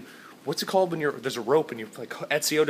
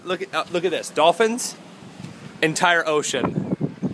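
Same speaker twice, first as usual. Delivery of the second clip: ordinary talk, nothing marked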